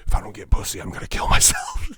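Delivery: dramatic laughing wail. Retold or imitated. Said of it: "I'd be like".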